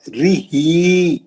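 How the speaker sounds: रिही with the final ee held for two counts; drawn-out final vowel in रिही; long ee. Shown as a demonstration. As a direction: stretch the end of रिही slowly. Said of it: "खड़ी जेर है".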